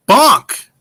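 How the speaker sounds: high, loud one-word shout; loud, high-pitched blurt; exclaimed bonk. Acted. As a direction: punch it out like an exclamation. Reading out exclaimed super chat.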